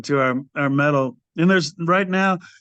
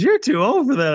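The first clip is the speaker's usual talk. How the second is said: higher mock-nagging wife voice, pitched well up; a hint of a scolding wife, pitched up; imitating his wife; 10 semitones higher than usual. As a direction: play the disapproving wife, voice lifted high. Wife scolding him about touring.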